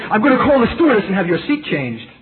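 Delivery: fed up, about to call for help; exasperated. Retold or imitated. Exasperated student warning the preacher. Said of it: "so exasperated".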